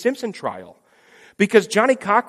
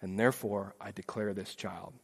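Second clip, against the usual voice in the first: very soft, low, tender verdict; solemn declaration; 8 semitones lower, 15 dB quieter than usual. Pretend. Jesus declares the child not guilty, soft and solemn. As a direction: quietly announce the victory.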